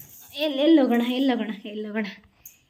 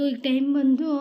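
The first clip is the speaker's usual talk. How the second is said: normal talking voice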